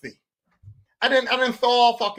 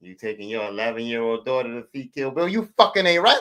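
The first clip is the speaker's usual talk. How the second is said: a low, disapproving stranger's voice; lightly voiced disapproving moviegoer; judging stranger; 9 semitones lower than usual. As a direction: scold like a shocked moviegoer. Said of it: "they look at me".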